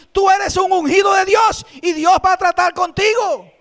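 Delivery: high, loud, shouted sermon; fiery preaching. Acted. Pastor urging the congregation, shouted preaching. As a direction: preach it at full force to the church.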